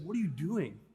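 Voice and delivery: soft, level wifely reproach; cool the ranting husband with a quiet question; retold quiet disapproval. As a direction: imitate the wife, dropping the volume. Wife questioning worked-up husband.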